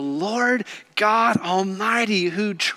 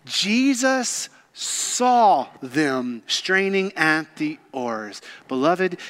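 usual speaking voice

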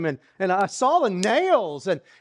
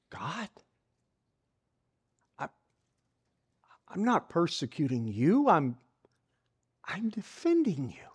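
stunned whisper; 2 semitones lower, 7 dB quieter than usual. Acted. Saul's stunned inner protest.

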